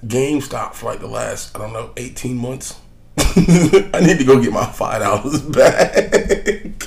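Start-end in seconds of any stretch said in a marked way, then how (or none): 3.2-6.7 slow, laughing through the words. Real, not acted. Joking to listeners about the preorder, laughing. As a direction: chuckle at your own silly preorder.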